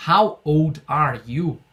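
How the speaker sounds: slow, clear English model phrase. Shown as a demonstration. Teacher models the question slowly for students. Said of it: "que na pergunta".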